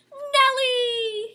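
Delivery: high, loud shout; shouting prince. A narrator's reading. Tied-up prince shouting for Nell, high and loud. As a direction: call out for her in alarm. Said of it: "he shouts".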